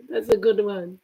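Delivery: usual speaking voice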